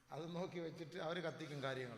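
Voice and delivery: normal talking voice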